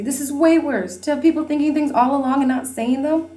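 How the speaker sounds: high, loud tearful cry; sobbing. Acted. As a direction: sob it out, hurt. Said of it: "sobbed Lizzie".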